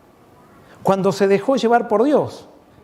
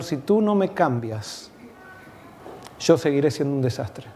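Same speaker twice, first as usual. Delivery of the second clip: soft prayer; 4 semitones lower, 4 dB quieter than usual. Acted. Softly prays psalm over music.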